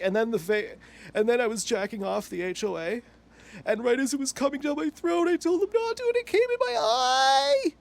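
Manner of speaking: high wailing imitation, climbing to the end; hammed-up tearful complaint, pitch soaring; mock crying. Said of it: "He's almost crying about complaining".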